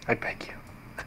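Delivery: breathy begging whisper; whispered plea. Pretend. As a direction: beg in a whisper. Pleading after the ghost scare.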